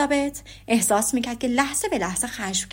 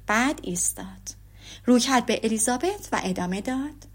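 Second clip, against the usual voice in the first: ordinary delivery, nothing marked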